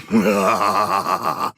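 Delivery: short hearty monster laugh; creature laugh. Acted. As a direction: laugh like a pleased beast after the blast. Laugh after explosion.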